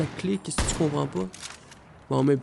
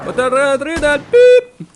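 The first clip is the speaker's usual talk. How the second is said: loud, very high, drawn-out mock yell; self-censored yell; 10 semitones higher, 14 dB louder than usual. Player censoring his swearing for children, mock yell. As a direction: yell it slowly with a high bleep at the end. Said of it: "On va mettre des bips".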